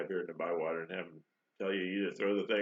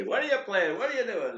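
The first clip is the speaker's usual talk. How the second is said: high-pitched, louder mock questioning; imitating puzzled questioners; 12 semitones higher, 5 dB louder than usual. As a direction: pester him like a baffled onlooker. Acting out people's questions to him.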